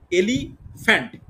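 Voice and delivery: Elephant slowly, with a gap before ফ্যান্ট; split in two. Shown as a demonstration. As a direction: pause inside elephant between এলি and ফ্যান্ট. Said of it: "তাহলে কটা হচ্ছে?".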